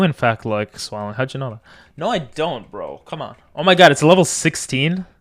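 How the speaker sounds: normal talking voice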